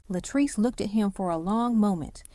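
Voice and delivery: ordinary delivery, nothing marked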